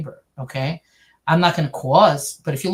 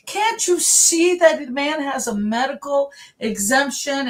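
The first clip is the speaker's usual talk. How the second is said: high-pitched, drawn-out imitation of yelling; mock yelling; 9 semitones higher, slower than usual. Imitating the other half of the church arguing back, mock yelling. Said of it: "they're yelling at each other".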